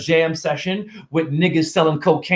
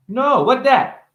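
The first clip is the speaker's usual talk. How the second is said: put-on higher little kid voice; 9 semitones higher, slower than usual. A kid answering a stranger, higher small voice. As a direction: answer like a shy ten-year-old.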